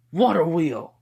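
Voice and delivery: loud, high battle shout of the move; attack call. Acted. Tanjiro naming his sword move mid-air, high shout. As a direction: shout the technique name out high.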